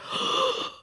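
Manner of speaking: sharp mock gasp of alarm; startled gasp. Retelling a split-second gator scare, startled gasp. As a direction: suck in a breath, startled. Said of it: "I was like".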